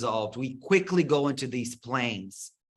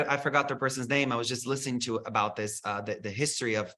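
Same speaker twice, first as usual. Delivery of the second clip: ordinary talk, nothing marked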